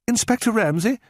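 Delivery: high, startled question; anxious at the door; a woman afraid of bad news; frightened. A narrator's reading. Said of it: "She sounded frightened".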